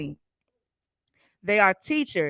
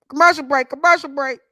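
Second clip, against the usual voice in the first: calling out; 6 semitones higher, 6 dB louder, faster than usual. Real, not acted. Host calling a break.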